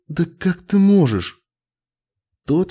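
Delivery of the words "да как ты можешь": high, appalled reproach; aghast, voice lifted high; shocked. A narrator's reading. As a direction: protest in horrified disbelief. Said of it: "ахнула Джилл".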